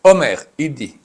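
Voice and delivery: ordinary delivery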